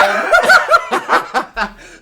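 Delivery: high, loud burst of laughter; loud cackle. Real, not acted. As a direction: cackle at your own story. Cackling at the missing beep.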